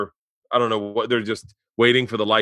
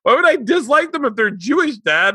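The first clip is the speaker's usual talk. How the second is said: put-on much higher, loud, indignant little girl; indignant kid daughter; 6 dB louder than usual. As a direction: squeak it out like an offended kid. Voicing daughter's indignant reply.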